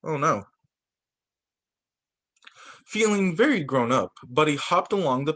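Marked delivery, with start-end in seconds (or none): none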